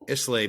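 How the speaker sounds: Islay sounded out as ISS-lay; mistaken pronunciation. Shown as a demonstration. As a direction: pronounce the s in Islay, ending on lay. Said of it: "I call it".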